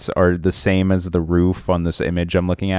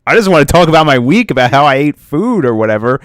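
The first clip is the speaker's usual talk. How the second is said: excited; 8 semitones higher, 5 dB louder than usual. Real, not acted. Laughing excitedly about Mister Pants.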